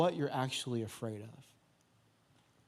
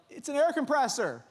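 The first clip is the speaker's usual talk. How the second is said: raised, higher-pitched call; kids calling out; 9 semitones higher than usual. Retold or imitated. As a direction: mildly imitate kids trying to get through to Dad. Kids calling to alarmed dad.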